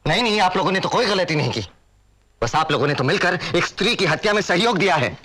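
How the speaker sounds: high-pitched, indignant sarcasm; bitter accusation. Acted. Son accusing his family of a killing, bitterly indignant. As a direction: accuse them with rising bitterness.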